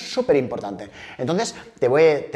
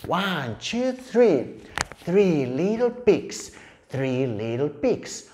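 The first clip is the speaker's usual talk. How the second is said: rhythmic picture-book chant; storytelling for toddlers; 3 semitones higher, much slower than usual. Shown as a demonstration. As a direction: count slowly and playfully for little ones.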